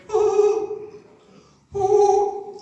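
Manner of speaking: owl hoot. Retold or imitated. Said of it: "the Englishman up the tree went".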